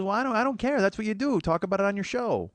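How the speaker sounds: a dismissive mother, voiced up high; imitating his mom.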